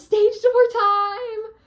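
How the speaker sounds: high, loud and slow with a laugh; giddy stage door squeal; excited squeal. Real, not acted. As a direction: announce it like the best moment ever. Excited announcement.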